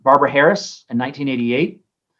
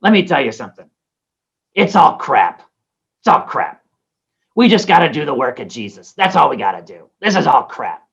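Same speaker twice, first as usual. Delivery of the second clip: imitating Barbara Harris. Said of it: "She had a deep voice".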